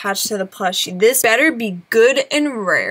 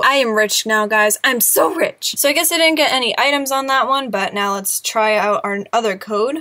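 ordinary talk

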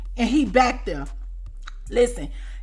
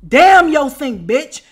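louder, slow retort to the boss; defiant retort; 2 semitones higher, 9 dB louder, slower than usual. Retold or imitated. To the boss about the sink, a slow loud retort. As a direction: snap it back at her.

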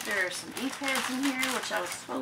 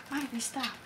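quiet aside; 3 semitones higher, 7 dB quieter than usual. Real, not acted.

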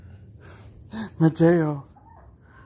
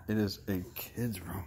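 whispered aside; 7 semitones lower, 11 dB quieter than usual. Real, not acted. Parent whispers a joke about the living room.

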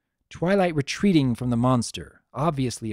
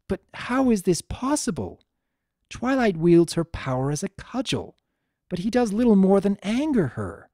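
higher, amazed regal voice; astonished princess; 6 semitones higher than usual. Acted. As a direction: marvel at what you're seeing. Luna astonished by the vision.

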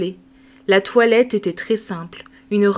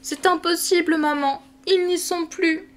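put-on crying child; 8 semitones higher than usual. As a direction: sob it out like a little girl. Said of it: "Sophie pleurant".

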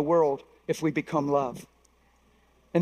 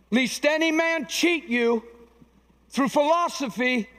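high, slow declaimed scripture; preached warning; 6 semitones higher, slower than usual. Real, not acted. Preacher warning the church against empty deceit, declaiming. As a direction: space out each phrase as a solemn warning.

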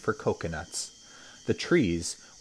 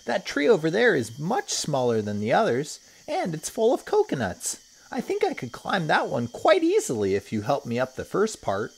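put-on higher, louder little boy voice; a keen boy sure he can climb it; eager boy; 10 semitones higher, 4 dB louder than usual. Toto speaks to his sister.

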